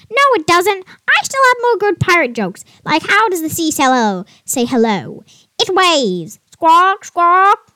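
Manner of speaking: high, loud squawky parrot. Acted. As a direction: chirp the jokes like a cheeky parrot. Parrot tells jokes.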